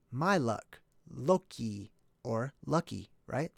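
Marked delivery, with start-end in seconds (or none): none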